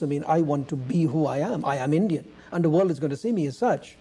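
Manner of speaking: usual speaking voice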